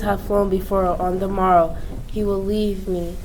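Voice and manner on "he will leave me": usual speaking voice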